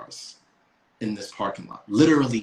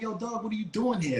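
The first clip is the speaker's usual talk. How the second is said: mock cop question; 8 semitones higher, 4 dB quieter than usual.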